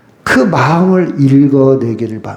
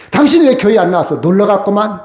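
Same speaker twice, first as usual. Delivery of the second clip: rushed mock reproach, a bit higher; accusing jab; 6 semitones higher, faster than usual. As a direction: fire off the blame before hearing them out. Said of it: "이렇게 말하지 마세요".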